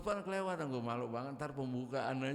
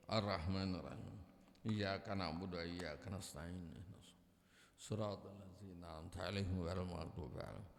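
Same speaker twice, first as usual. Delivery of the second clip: prayer recitation; 7 semitones lower than usual. Acted. Preacher leading the congregation in the closing prayer. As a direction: recite Al-Fatihah devoutly.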